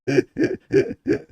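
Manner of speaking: high helpless laugh; high burst of laughter. Real, not acted. Streamer losing it over her own blunder, high laugh. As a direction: laugh it out, can't hold it. Cracking up after misreading.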